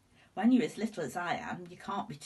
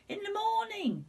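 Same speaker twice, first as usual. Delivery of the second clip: high, louder eager call; excited cry; 4 dB louder than usual. A narrator's reading. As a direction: call it out with glee. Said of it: "I cried".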